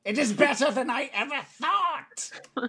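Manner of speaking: high, loud, slow goblin delight; super happy goblin. Acted. Goblin hearing his trap worked, super happy. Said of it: "he's super happy about the efficacy".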